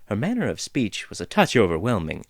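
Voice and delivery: ordinary delivery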